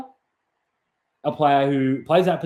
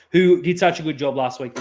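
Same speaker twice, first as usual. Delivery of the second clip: ordinary delivery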